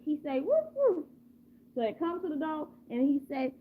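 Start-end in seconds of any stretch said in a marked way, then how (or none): 0.4-1.0 high double woof; two polite little barks, pitched way up; nice dog bark. Acted. Nice dog barks at the door for food, politely. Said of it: "So then the other dog".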